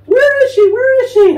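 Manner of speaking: high, loud searching call. Retold or imitated. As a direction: nurses shouting down the hall for her. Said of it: "yelling for me".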